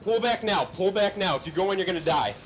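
loud, high repeated order; commanding shout. Real, not acted. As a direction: bark the retreat order. Ordering team retreat.

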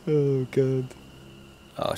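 ordinary talk, nothing marked

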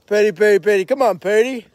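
loud high call. Real, not acted. Owner calling pet over, loud high call.

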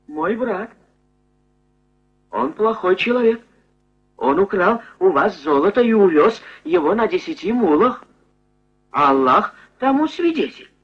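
high, wheedling informer voice; fawning traitor. Acted. Captured brother denounces Ali Baba to robbers, high and wheedling. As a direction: sell out your brother eagerly. Kasim betrays brother, character voice.

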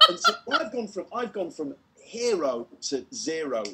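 ordinary delivery

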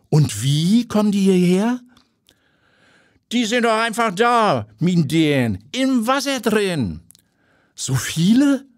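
high character voices trading quick questions; light dialogue voices; curious chat. Acted. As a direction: reporter probing, old lady shrugging. Reporter and bather exchange.

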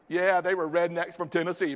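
normal talking voice

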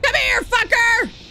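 loud, high, drawn-out battle shout; angry yell. Real, not acted. Gamer charging at a snake enemy, angry yell. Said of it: "I'm yelling and I'm being obnoxious".

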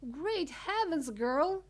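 high, loud shocked exclamation; screech. A narrator's reading. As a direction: screech it as a horrified mother. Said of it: "screeched Missus Beauregard".

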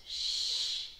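long, gentle shush; drawn-out unvoiced shh; hushing shh. Real, not acted. Hushing kids before they answer.

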